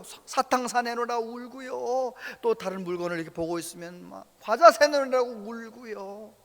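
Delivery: ordinary talk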